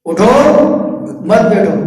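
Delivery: rising اٹھو, pause, falling مت بیٹھو; "اٹھو" up, wait, "مت بیٹھو" down; pause after اٹھو. Shown as a demonstration. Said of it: "بات الفاظ تو وہی ہے".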